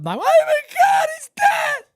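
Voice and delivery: shrieking falsetto wail, very high and loud; hammed-up high-pitched hysterical screaming; hysterical wife. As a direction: scream for help like a panicked wife. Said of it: "Carol calls up".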